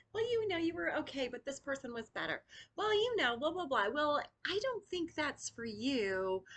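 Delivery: high, condescending mock voice of a critic; dismissive critic. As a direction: sneer it down at them from above. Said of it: "when you're used to being told".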